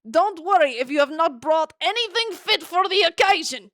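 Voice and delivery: put-on high, loud Dracula accent. Viscount Takaris receives his guests, Dracula accent. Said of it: "gonna be doing that accent for Takaris".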